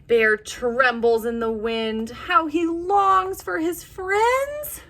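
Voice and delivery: lively storybook read with voiced animals; reading to children. A narrator's reading. Teacher reading a bear story to little kids, animated. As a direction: tell it like a bedtime picture book. Reads picture book aloud.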